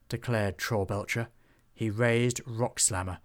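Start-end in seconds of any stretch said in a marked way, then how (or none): none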